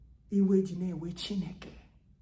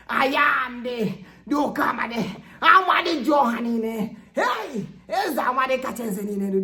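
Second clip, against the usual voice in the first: preaching at full force; 5 semitones higher, 10 dB louder than usual. Real, not acted. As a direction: proclaim each title like a trumpet. Loud proclamation of titles.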